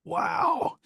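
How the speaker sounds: high, sudden wow; amazed. Real, not acted. Friend reacting to ghost story, amazed high wow. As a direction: blurt out astonishment.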